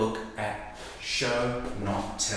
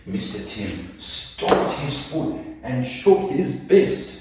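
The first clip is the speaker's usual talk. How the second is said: slow, building story read; dramatic reading; 4 semitones higher, 6 dB louder, slower than usual. Shown as a demonstration. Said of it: "might sound something more like this".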